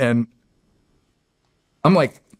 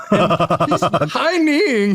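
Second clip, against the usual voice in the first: slow, high, laughing through the words; cracking up mid story; 8 semitones higher, much slower than usual. Real, not acted. Speaker telling a funny memory, laughing. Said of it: "laughing while telling".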